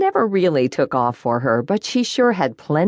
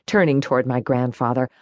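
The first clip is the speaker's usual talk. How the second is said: usual speaking voice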